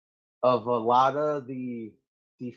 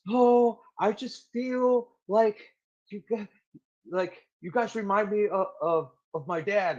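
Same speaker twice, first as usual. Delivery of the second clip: imitated bawling; 8 semitones higher than usual. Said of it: "bawling out".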